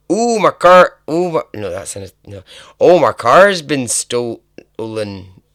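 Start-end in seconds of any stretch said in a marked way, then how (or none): 0.1-1.4 high, loud mock Canadian; badly faked Canadian accent, high-pitched and loud; attempted Canadian accent. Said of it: "what does a Canadian sound like?". 2.8-5.4 loud, drawn-out mock Canadian; hammed-up Canadian attempt, slow and loud; failed Canadian accent. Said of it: "That's not Canadian".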